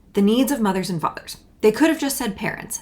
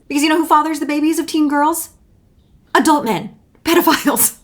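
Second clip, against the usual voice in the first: louder, higher, building to a shout; rising anger; 6 semitones higher, 6 dB louder than usual. Real, not acted. A creator furious at Republicans over teen births, shouting. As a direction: hammer it home, furious. Angry rant about pedophiles.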